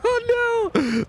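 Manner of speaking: real startled cry; shocked dismay. Real, not acted. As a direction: yelp it in sudden dismay. Right after the shriek.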